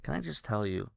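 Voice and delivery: usual speaking voice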